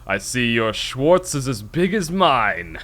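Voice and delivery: slow, drawn-out movie villain voice; villain quote. Retold or imitated. Quoting the Spaceballs villain line to his cohost, slow and sneering. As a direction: ham up the sneering villain slowly.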